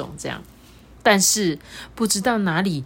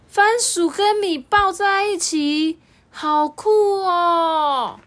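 high, very slow amazed drawl; childlike awe, pitched up; amazed child; 13 semitones higher, 5 dB louder, much slower than usual. Acted. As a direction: wonder at the find. Boy amazed.

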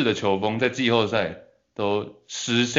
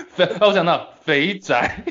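laughing; 5 semitones higher, 4 dB louder than usual. Real, not acted. Laughing while answering.

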